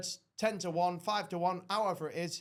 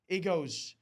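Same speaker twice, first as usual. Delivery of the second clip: ordinary talk, nothing marked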